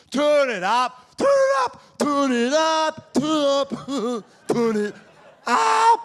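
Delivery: varied heckler yells. Retold or imitated. Comic mimicking the heckler's many inflections. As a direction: play with the tones of every repeat. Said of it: "different tones and inflections".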